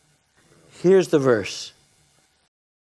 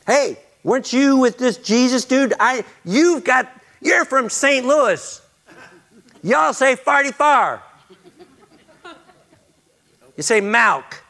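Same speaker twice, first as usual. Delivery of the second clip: joking accuser; 12 semitones higher, 3 dB louder than usual. Acted. Jokey accuser voiced in story.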